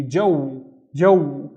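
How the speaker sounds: JOW closed with an oo glide; careful isolated "jow"; syllable demo. Shown as a demonstration. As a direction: say "jow" alone, then repeat it. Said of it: "I also need to pronounce the u".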